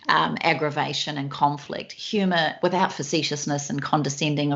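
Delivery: usual speaking voice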